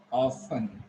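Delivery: "often" pronounced OFF-un; silent T. Shown as a demonstration. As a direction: drop the T in "often". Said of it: "this way you can pronounce".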